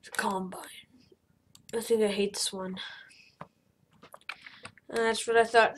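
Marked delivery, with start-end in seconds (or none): none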